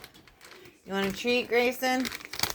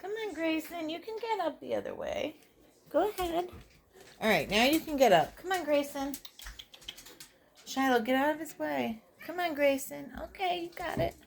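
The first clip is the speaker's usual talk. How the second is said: coaxing a pet; 7 semitones higher than usual. Real, not acted. Coaxing cat, high.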